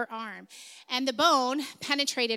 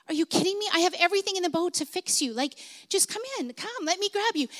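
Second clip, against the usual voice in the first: higher, urgent pleading; pleading dad; 7 semitones higher, faster than usual. Retold or imitated. Father urgently pleading with his drowning daughter, higher and urgent. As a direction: beg her to let you help.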